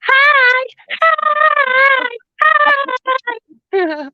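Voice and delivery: high squeaky mock greeting; BHB impression. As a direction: mimic her silly high hi. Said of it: "a B H B impression".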